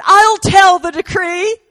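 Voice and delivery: high, loud, slow proclamation; Jesus bursting in with loud high decree; eager shout. Acted.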